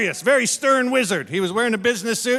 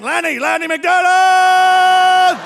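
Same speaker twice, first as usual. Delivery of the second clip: slow, loud, higher proud yell; triumphant shout; 7 semitones higher, 7 dB louder, much slower than usual. Retold or imitated. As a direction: nail it like a game show winner. Drunk fumbling quiz answer triumphantly.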